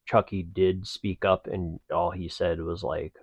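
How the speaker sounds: usual speaking voice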